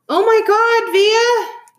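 very high, loud squeal; overjoyed high-pitched shriek; excited screaming. A narrator's reading. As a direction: scream it like a thrilled mom. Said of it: "Mom was screaming with excitement".

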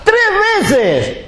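high, amazed cry of tres veces; laughing at the absurdity; incredulous exclaim. Real, not acted. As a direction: throw it up high in disbelief. Exclaiming at three burglaries.

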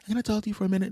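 high-pitched imitation of a woman; mock woman's voice. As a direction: put on a high female voice asking politely. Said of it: "and she says".

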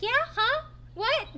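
mock baffled. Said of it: "they're like".

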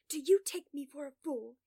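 loud, indignant challenge; sharp, loud retort; demanding. A narrator's reading. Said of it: "he demands".

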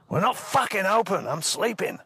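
high-pitched angry outburst. Acted. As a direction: cut in as an irate, woken-up receptionist. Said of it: "Nah, he was angry anyway".

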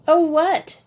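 high, loud sudden oh what; spring up curious; Joey sitting up in bed, eager to know. Acted. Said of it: "Joey started up in bed".

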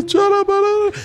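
high-pitched babble of tongues; imitated high gibberish outburst. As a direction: burst into mock ecstatic syllables. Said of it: "tipo, o Espírito Santo, tipo".

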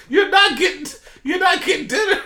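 high, loud words through laughter; can barely get it out for laughing; squealing with laughter; laughing hard. Real, not acted. Cracking up at the joke.

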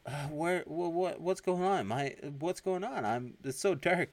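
high, stammering mock panic; confused panic. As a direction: act out waking bewildered in the dark. Brain wakes confused in dark.

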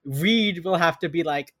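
ordinary talk

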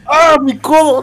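high, loud yelp of pain over an elbow; mock pain cry. Friend retells a buddy's elbow injury with a mock pain yelp.

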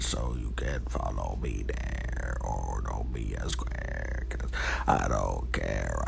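low droning robot voice. Acted. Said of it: "trying my best to sound like a robot".

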